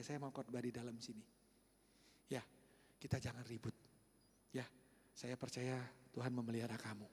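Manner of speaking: low, soft, calming words; gentle reassurance. Retold or imitated. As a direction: soothe the boy kindly. Pastor gently settles with boy.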